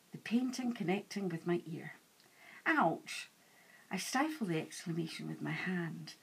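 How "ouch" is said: a quick yelp of pain, held back; sharp ouch. A narrator's reading. Hiding woman yelps as painting hits her ear, stifled ouch. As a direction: cry out as the frame hits the ear.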